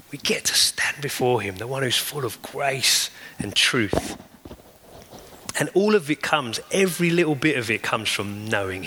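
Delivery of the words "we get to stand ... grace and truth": hushed whisper. Real, not acted. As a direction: breathe it out in awe before him. Awed hush before the risen Lord.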